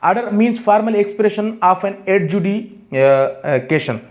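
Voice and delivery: very slow definition read; slow dictation. Shown as a demonstration. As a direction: dictate every word for notes. (बहुत धीमा श्रुतलेख)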